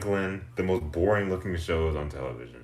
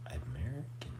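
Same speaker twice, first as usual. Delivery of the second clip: whispered aside, much softer; 4 semitones lower, 16 dB quieter than usual. Real, not acted. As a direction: drop into a secret whisper for the doubt. A quiet aside after a claim.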